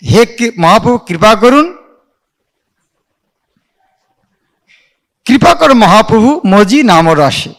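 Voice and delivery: slow, drawn-out devotional recital; prayer recitation. Shown as a demonstration. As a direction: intone the verse like a prayer. (ধীরে প্রার্থনার সুরে আবৃত্তি)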